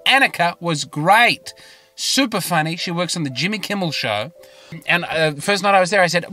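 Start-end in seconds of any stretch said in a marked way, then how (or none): none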